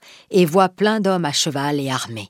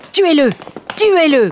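a much higher, much louder yell; shouting; 11 semitones higher, 6 dB louder than usual. Acted. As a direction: order the men to kill him. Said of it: "crie Coconnas".

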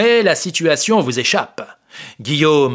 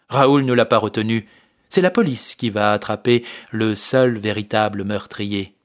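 ordinary delivery

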